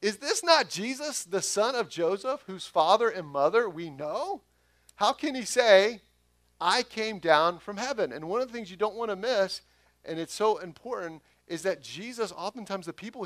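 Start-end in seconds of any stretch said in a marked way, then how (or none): 0.0-8.1 much higher and louder, scoffing disbelief; mock the upstart hometown boy; incredulous crowd. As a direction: neighbours scoffing at Jesus. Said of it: "they said".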